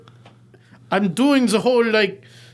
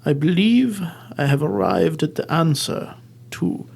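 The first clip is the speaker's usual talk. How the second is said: dramatic detective reveal; 6 semitones lower than usual. Acted. Detective to the suspects, a lightly played dramatic reveal. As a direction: unveil the killer like a stage sleuth. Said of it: "I'm doing the monologue where I go through all the evidence".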